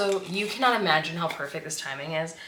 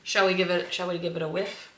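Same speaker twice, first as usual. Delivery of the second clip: ordinary talk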